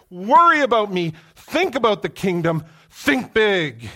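booming, high, loud voice of God urging them to think big; preaching at full force. Acted. Preacher speaking as God to the worried congregation, booming. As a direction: thunder it out like God rebuking worry.

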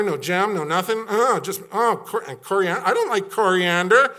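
whiny complainer. Acted. Preacher voicing Israelites whining about food. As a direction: gripe like a spoiled traveler.

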